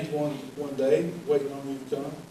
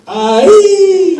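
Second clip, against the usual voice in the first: high, loud whooping shout; correct war whoop; 13 semitones higher, 15 dB louder than usual. Retold or imitated. Correcting the players' chant, loud high whoop. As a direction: belt out the proper war cry. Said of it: "No, it's".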